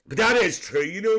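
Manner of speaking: usual speaking voice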